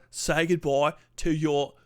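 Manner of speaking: ordinary talk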